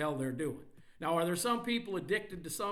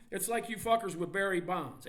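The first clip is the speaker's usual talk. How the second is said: usual speaking voice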